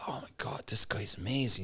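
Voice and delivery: hushed, awed whisper; whispered awe. Retold or imitated. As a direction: whisper it like a starstruck fan. Awed quote of fan.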